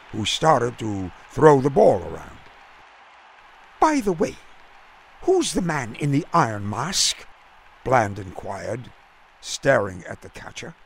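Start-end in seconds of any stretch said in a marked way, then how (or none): 3.8-7.3 a touch of a high, prim English voice; curious, politely puzzled gent; lightly voiced English gentleman. A narrator's reading. Bland asks Jack, politely puzzled, lightly English.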